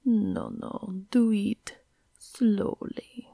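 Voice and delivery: slow, purring bad French accent; terrible French accent. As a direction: ham up a French accent, drawn out. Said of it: "He feigned a terrible French accent and purred".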